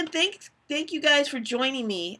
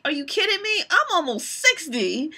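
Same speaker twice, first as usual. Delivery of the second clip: mother's comeback; 6 semitones higher than usual. Retold or imitated. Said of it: "she's like".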